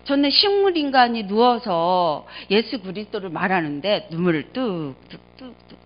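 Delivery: ordinary talk, nothing marked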